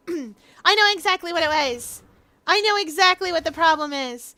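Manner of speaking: high, loud courtroom declaration; defense lawyer, insistent. Acted. Lawyer revealing the blackmail letter's real target, urgent. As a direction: declare it forcefully, as if cracking the case. Voices lawyer making accusation.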